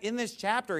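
ordinary delivery

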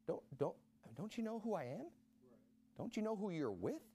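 quiet, gentle question; soft reassuring rebuke. Acted. Jesus gently reminds his followers who is with them, soft and quiet.

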